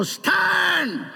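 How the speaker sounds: high, long-held cry; retold command. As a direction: call it out high like a stubborn order. Retold command to stand firm.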